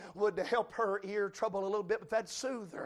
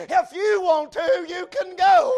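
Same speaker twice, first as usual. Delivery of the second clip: louder, higher shouted invitation; loud preached refrain; 10 semitones higher, 14 dB louder, slower than usual. Acted. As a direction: call it out to the whole church. Repeated sermon refrain, shouted.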